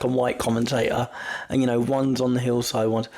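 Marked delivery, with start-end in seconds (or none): none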